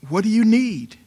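loud, high-pitched divine appeal; urgent appeal. Acted. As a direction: call it out loud and high, pleading as God to the people. Voicing God's urgent call.

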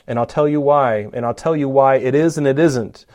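normal talking voice

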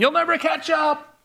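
high, sing-song taunt called back over the shoulder; mock taunt. Busy people rushing past taunt the one who stopped, mocking and high.

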